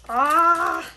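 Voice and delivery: short, loud, high squeal of delight; excited squeal. Real, not acted. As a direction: squeal at the surprise. Spots something in the package.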